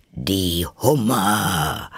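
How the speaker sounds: slow, theatrical mock yell; mild character yell. A narrator's reading. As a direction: stretch it out like a contained shout. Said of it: "schrie der Greif".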